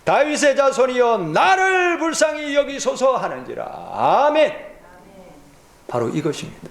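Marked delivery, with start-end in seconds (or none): 0.0-3.2 crying out louder. Acted. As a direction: call out even louder. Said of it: "더욱 크게 소리 질러 이르되".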